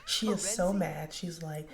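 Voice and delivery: ordinary talk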